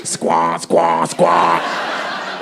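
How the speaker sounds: low, repeated honking squawk; imitated squawking noise. Comic trying to wake it up, low squawking noises. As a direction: honk it low like a dead machine.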